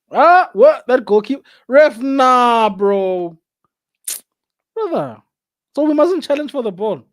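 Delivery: loud indignant shout at the ref; outraged protest. Real, not acted. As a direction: yell in disbelief at the call. Protesting a referee call.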